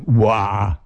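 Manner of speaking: drawn-out "uah" of revulsion; acted shudder at the thought. A narrator's reading. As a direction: shudder out a loose, open-mouthed groan. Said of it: "Susi schüttelte sich".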